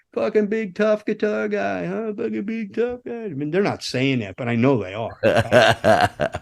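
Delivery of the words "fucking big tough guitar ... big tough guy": high, soft jeering voice; mocking taunt. Imagined shop guys sneering at the tech, mocking. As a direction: jeer under your breath in a pitched-up voice. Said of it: "the guys are laughing at me in the shop".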